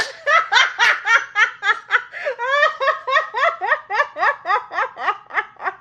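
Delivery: high squealing laugh. Real, not acted.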